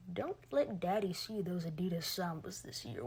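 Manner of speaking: low, quiet mocking menace; mock dominant threat. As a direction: play at being the threatening boss. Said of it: "threatening and dominant after saying that".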